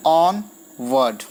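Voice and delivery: slow "ऑन word", strong "ऑन", falling "word"; syllable-by-syllable scansion. Shown as a demonstration. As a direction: lean on "ऑन", pause before "word". Teacher scanning word by word. ("ऑन" पर ज़ोर, "word" अलग से)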